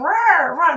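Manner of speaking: high, loud imitation of a squabbling crowd; imitated roar of people arguing; mock clamour. Imitating the church crowd going at it, mock clamour. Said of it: "And they're going".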